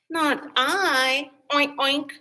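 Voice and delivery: lightly voiced pig, oinking. A narrator's reading. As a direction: refuse like a lazy pig. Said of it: "But the pig said".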